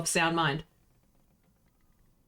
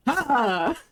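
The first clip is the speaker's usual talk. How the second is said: captain's booming laugh; 4 semitones higher than usual. Acted. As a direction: bark out one laugh. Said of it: "The captain's laugh rings out".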